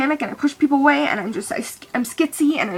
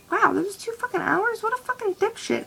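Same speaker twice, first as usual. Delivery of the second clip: much higher, incredulous scoff; disbelief; 11 semitones higher, 6 dB quieter than usual. Real, not acted. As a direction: realize how long he took and can't believe it. Disbelief at two-hour reply gap.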